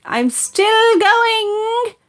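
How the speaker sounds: crying hag screech, very high and very slow; old screechy voice. A narrator's reading. Grandma from the attic, screeching. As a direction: wail it out like a mad witch. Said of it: "came the old screechy voice".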